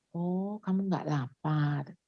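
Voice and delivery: very soft, slow acknowledgement; quietly and slowly reflect it back; acted understanding mother, low and hushed; gentle parent. Models calm reply to child.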